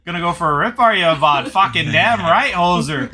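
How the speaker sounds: put-on Canadian accent. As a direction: play a loud Letterkenny-style Canadian. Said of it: "I'm going Canadian, eh?".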